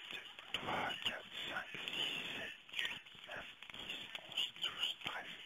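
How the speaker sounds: counting the cards in a whisper; murmured count while dealing cards. Real, not acted. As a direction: whisper the numbers under your breath. Counting cards under breath.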